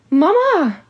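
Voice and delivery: high, loud cry of Mama!. A narrator's reading. Hattie crying out to her mother, a high, loud cry. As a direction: shriek for her mother in dismay. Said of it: "Hattie cried".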